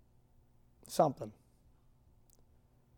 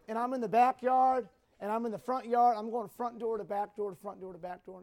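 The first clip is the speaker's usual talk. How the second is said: usual speaking voice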